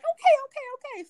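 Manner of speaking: high, loud, rushed okay okay okay; hasty okays. Retold or imitated. Giving in to security guards.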